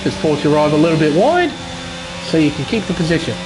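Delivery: normal talking voice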